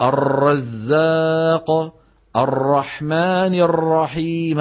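"الرزاق" and "الرحمن الرحيم" with an over-trilled ra, slow; rolled ra. Shown as a demonstration. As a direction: roll the ra too many times. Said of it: "ما بيصح". (تكرير مبالغ للراء)

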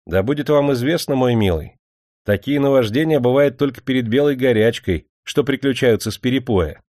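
plain reading voice

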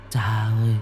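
soft, sorrowful lament; mournful ghost child. Acted. Murdered son calling to his father, mournful and soft. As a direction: draw the call out softly and plaintively.

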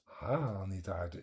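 ordinary talk, nothing marked

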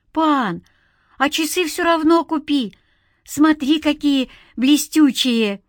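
put-on high, eager child's voice; pestering kid showing off his goods; wheedling boy. A narrator's reading. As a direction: coax like a little street seller.

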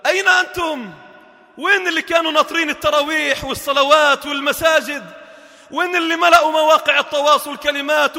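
loud, high preacher's call; impassioned loud sermon; full-force preaching. Acted. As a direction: rebuke the crowd from the pulpit.

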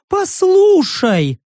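very high, drawn-out cry; desperate interruption. A narrator's reading. Stranger begs the commander to listen, desperate.

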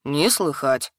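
mumbled through a bite of sausage; troll chewing, mumbling low; mouth full. A narrator's reading. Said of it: "надкусив её, промычал".

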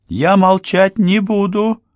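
high, loud, slow defiant cry; shouted. A narrator's reading. Anne refuses to stay silent before Ralph, high loud shout. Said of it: "выкрикнула девушка".

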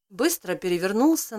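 usual speaking voice

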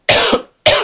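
harsh dry cough; coughing fit. Real, not acted. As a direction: cough hard, mid-answer. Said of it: "estou cheia de tosse".

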